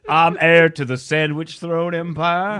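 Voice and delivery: hammed-up aristocratic boast; haughty heir. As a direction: declare it grandly as a mock noble heir. Plays the sandwich heir, boasting.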